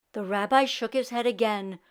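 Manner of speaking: ordinary delivery, nothing marked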